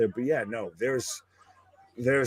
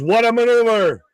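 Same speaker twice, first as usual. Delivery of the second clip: imitated commentator's big call; much higher and louder, hammed-up ringside exclamation; Vince; 11 semitones higher, 9 dB louder than usual. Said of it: "Vince was".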